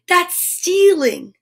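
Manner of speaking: high, slow, drawn-out indignation; crying out. A narrator's reading. Naughty accusing the goblins taking his car, crying out. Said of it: "cried Naughty".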